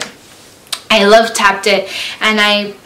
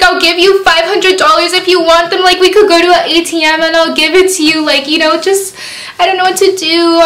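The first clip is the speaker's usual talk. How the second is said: high, hurried tearful bargaining; mock-crying plea, pitched up; retold crying; 7 semitones higher than usual. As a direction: imitate begging through tears. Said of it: "I was just crying".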